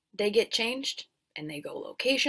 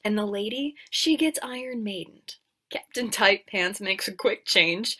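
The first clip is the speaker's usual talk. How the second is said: ordinary talk, nothing marked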